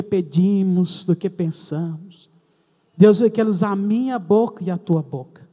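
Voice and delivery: rally the congregation; preaching. Acted. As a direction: preach with rising force. Preaching builds up.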